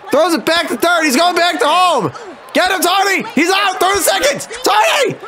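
high, shouted panic turning to rage; real high-pitched gamer meltdown; frantic raging. Real, not acted. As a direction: yell desperately as the play falls apart. Play falls apart, rages at team.

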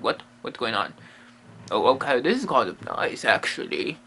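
low, soft puzzled dog voice. Pretend. Dog's inner voice, low.

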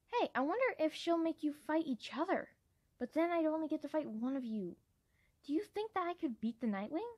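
put-on a keen young fighter itching to test herself; eager young dragon Peril.

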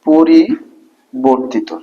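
very slow, drawn-out syllables with a pause midway; dictation. Shown as a demonstration. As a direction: spell it out slowly for note-takers. Dictating a term to write down.

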